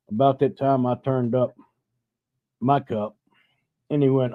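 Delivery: ordinary delivery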